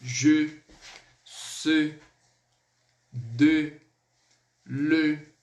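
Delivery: each syllable "Je", "ce", "de" said slowly with a short muted French e; french e sounds. Shown as a demonstration. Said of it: "a gente vai pronunciar esse ezinho". (cada sílaba com o e mudo francês bem marcado)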